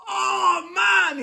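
hammed-up high, loud wail of defeat; mock-despairing cry of a beaten fan; mock anguish. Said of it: "the emotion caught up with me".

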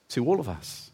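ordinary delivery

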